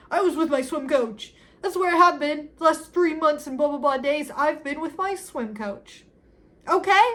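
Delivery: loud, high, exasperated tirade; dramatized yelling, ending on a high burst; shouted confession. Retold or imitated. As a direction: act out snapping under questioning. Acted shouted confession in quarrel.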